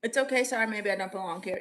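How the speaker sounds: ordinary talk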